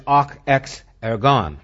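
"ok ex ergon" read out in Greek, syllable by syllable; Greek phrase. Shown as a demonstration. Preacher translating the Greek, careful and clear.

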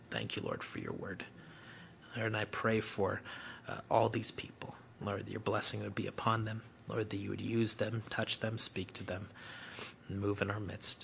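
a pastor's hushed closing prayer. Real, not acted. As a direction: pray it quietly over the congregation.

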